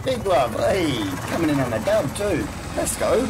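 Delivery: loud, high hype shout-out; excited hype. Real, not acted. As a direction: welcome the raiders, all energy. Hyping an incoming stream raid.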